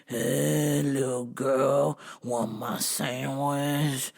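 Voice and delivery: low, slow zombie drone. Acted. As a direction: groan it out like the undead, slowly. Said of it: "coming out of the bushes like they were zombies".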